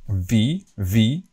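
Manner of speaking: V with a bite of the lip; English V. Shown as a demonstration. Teacher shows the English V, lip bitten. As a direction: say V with teeth on the lip. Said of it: "uh, lip".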